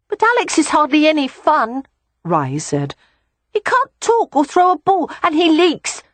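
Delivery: put-on a little boy grumbling about his baby brother; high whiny child voice; complaining small boy. A narrator's reading.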